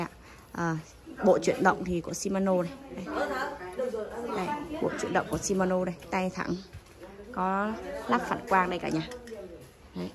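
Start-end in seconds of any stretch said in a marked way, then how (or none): none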